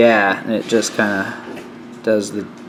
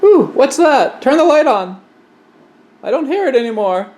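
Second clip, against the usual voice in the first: high-pitched puzzled act; mock startled. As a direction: play someone hearing a weird noise. Acting out confused cricket victim.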